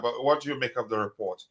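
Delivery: ordinary delivery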